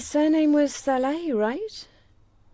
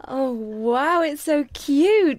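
gushing admiration; 2 semitones higher, much slower than usual. Acted. Shop customer admiring baby things, gushing. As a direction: coo over it, stretching the words.

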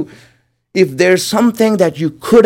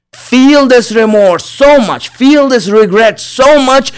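higher, slow, fervent plea to feel remorse; fervent urging; 5 semitones higher, 3 dB louder than usual. Acted. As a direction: press the listeners to feel the regret, slowly. Preacher urges listeners to feel remorse.

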